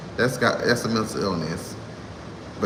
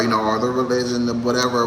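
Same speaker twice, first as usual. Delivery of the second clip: ordinary talk, nothing marked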